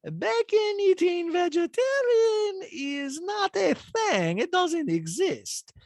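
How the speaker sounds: very high, slow mock Bosnian accent; Diana's accent impression. Host imitating Diana's talking head, very high Bosnian accent. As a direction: deliver it in a put-on Bosnian accent, deadpan. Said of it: "Diana, in her talking head, goes".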